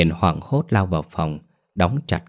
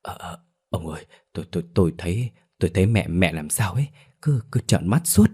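trembling voice; faster than usual. A narrator's reading. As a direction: falter nervously, then rise in alarm. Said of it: "giọng run run".

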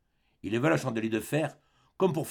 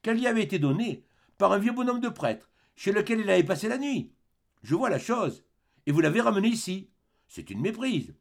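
en souriant; 10 semitones higher, 3 dB louder than usual. Acted. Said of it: "en souriant".

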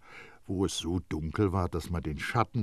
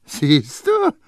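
high, loud, mocking old perfumer; Baldini; 8 semitones higher, 11 dB louder than usual. A narrator's reading. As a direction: needle the boy with high-pitched scorn. Baldini voiced by narrator.

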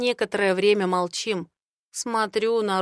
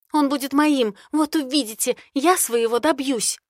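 determined vow; 6 semitones higher, 4 dB louder than usual. Acted. Ri vows determinedly.

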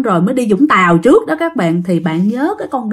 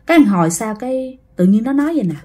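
plain reading voice

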